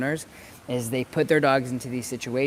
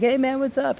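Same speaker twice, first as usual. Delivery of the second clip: much higher, louder buddy greeting; dog greeting a dog; 13 semitones higher, 5 dB louder than usual. Retold or imitated. Dog meeting another dog, high and excited. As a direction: squeal it like an excited pup. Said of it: "be like".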